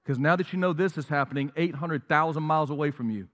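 usual speaking voice

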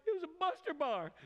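high, choked-up retelling of the moment; tearful. Retold or imitated. Preacher reliving his tearful memory, choked up. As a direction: voice rising as the tears come. Tearful realization at breakfast table.

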